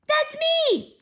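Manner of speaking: put-on high, proud little-kid voice; proud toddler. Toddler Fudge recognising himself in the commercial, proud. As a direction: announce it like a delighted small child.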